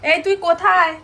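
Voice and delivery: calling out to her daughter in the dark room. Real, not acted. Mother calling her little daughter, loud call.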